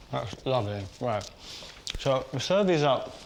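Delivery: talking with mouth full. Real, not acted. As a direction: speak through a mouthful of food. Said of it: "Sorry to talk with my mouth full".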